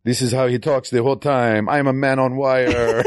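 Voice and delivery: high-pitched mock French accent; hammed-up bad French accent. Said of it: "with a bad French accent".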